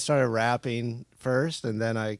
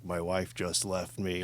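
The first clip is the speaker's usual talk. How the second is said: low, slow whippet voice; slowed, deep post-whippet croak; 4 semitones lower, 9 dB quieter than usual. Retold or imitated. Friend showing how a whippet drops your voice, low and slow. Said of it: "you do the whippet, then you say".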